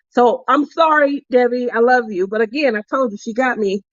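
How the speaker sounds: ordinary delivery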